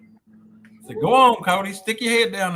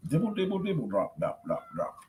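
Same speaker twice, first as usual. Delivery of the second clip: put-on reading to children. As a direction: read it like bedtime for a toddler. Reads a kids' book aloud.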